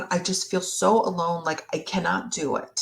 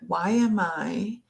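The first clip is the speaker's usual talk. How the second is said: slow, reflective self-question; slow yourself down as you ask it; slowing down to ask oneself why; 3 dB quieter, slower than usual. Shown as a demonstration. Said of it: "slow myself down".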